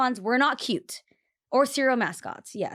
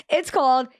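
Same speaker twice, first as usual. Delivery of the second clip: mock know-it-all; 4 semitones higher, 6 dB louder, much slower than usual. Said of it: "as the non-Latin person in the group".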